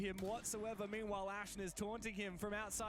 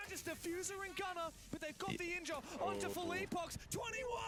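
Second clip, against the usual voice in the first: excited casting; 13 semitones higher than usual. Real, not acted. Caster calling a clutch.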